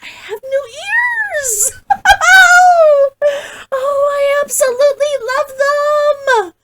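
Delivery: high, giggly squeal of delight; excited squeal. Real, not acted. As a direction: squeal it like you just unwrapped the best gift. Squealing over her new avatar ears.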